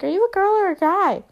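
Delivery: put-on high, loud little-kid voice; little girl. Little girl asking the podcaster after a haircut, childlike. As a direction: blurt it like a curious small child. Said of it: "I had this little girl come up to me".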